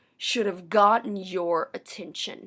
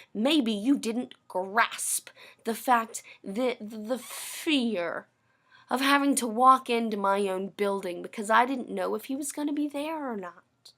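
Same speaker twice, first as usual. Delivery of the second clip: ordinary talk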